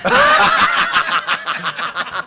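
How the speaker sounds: high, loud helpless laugh; loud burst of laughter. Real, not acted. Comic cracking up at his own punchline, loud laugh. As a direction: explode into laughter.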